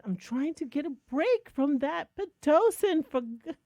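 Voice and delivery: exasperated plea. Retold or imitated. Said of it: "And I said".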